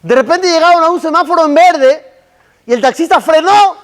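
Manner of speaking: ordinary delivery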